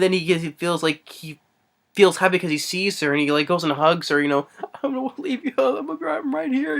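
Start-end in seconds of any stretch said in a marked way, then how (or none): 4.8-6.8 high, soft imitated soothing; light high voice, softened; acted reassurance. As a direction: mimic a father comforting his child, pitched high. Retelling father comforting daughter.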